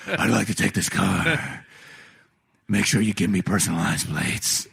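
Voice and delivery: lightly voiced Batman ordering plates; Batman at the DMV. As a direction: play a gruff caped hero asking for plates.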